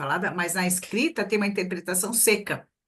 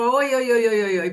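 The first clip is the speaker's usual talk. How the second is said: brisk hellos; 2 semitones higher, 7 dB louder than usual. Retold or imitated. Quick greetings passing the team.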